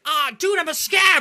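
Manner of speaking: loud, very high outraged wail; furious scav. Retold or imitated. Imitating the scav player, loud, high and furious. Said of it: "He was so mad".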